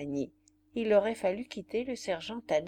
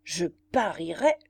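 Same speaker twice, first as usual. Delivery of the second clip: lively, louder exclamation; exclaiming; 5 dB louder than usual. A narrator's reading. Lieutenant calling out a bet to fellow officers, exclaiming. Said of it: "s'écria le lieutenant Henry".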